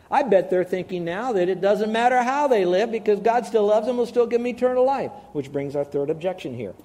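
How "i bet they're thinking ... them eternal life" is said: smug believer. Retold or imitated. Preacher voices believers' careless thinking.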